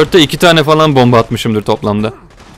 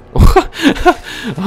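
high giggling cackle; high burst of laughter. Real, not acted. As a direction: shriek with laughter. Big laugh at game.

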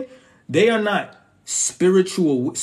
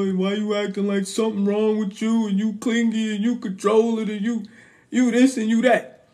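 higher, whiny mock accusation; mocking accusing partner; 7 semitones higher than usual. Voicing the partner who calls him clingy, mocking, higher. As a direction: rattle off the complaints in a higher mocking voice.

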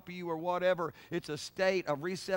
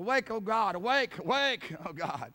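crying out to God; 3 semitones higher, 4 dB louder than usual. Acted. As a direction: call out as if rousing God from sleep. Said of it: "it's like Israel of old".

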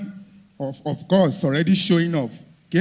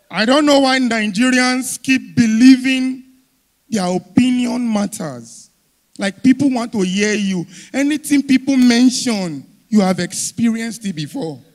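ordinary talk

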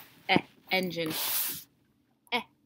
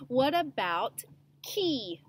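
ordinary talk, nothing marked

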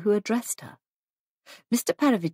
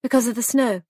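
higher, airy explanation; said lightly; 5 semitones higher, 4 dB louder, faster than usual. A narrator's reading. Hostess explaining away her trouble to a guest, light. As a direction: add it casually, pitched up. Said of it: "she said lightly".

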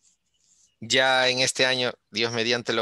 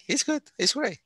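much higher, bright burst of praise; delighted praise. Real, not acted. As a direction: cheer the student on, pitched up. Teacher praising a student. (elogio entusiasta, mucho más agudo)

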